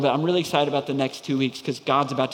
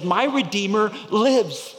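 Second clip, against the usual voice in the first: proclaiming Job; 6 semitones higher, 4 dB louder than usual. Acted. Preacher voicing Job's hope to the congregation, loud proclaiming cry. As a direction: proclaim it like a triumphant vow.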